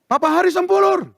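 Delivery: high call for a patient; imitating a nurse's summons. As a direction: call the name across a waiting room. Nurse calls his name for results.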